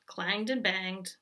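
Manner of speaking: ordinary delivery, nothing marked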